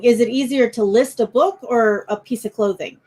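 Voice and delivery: normal talking voice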